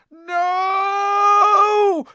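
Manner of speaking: panicked high yell. Acted. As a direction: scream it in a crash. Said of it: "Ron yelled".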